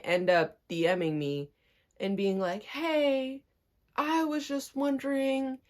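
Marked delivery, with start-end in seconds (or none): 2.6-5.6 higher, softer, drawn-out mock politeness; sheepish request, imitated. Imitating the guy asking in a DM, sheepish. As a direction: tiptoe into the favour, mockingly.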